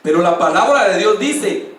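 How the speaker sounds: ordinary talk, nothing marked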